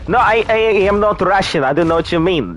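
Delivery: high, hammed-up Russian accent denying it; fake Russian accent. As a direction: play a joke Russian who insists he's not Russian. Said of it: "No, no accent".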